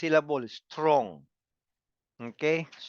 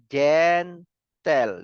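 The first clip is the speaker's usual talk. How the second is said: syllable by syllable; 4 dB louder than usual. Shown as a demonstration. Teacher counting two syllables for students, each said separately. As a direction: break it into two slow beats, GEN and TEL. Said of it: "When you say two syllables".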